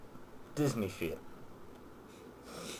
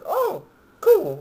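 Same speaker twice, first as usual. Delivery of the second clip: mock excitement; 5 dB louder than usual. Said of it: "I'm like".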